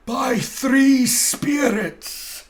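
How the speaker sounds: loud, high, slow spectral wail; booming ghost. Acted. Marley's ghost warning Scrooge, loud and wailing. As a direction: proclaim it like a doomed ghost.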